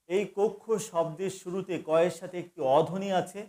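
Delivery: ordinary talk, nothing marked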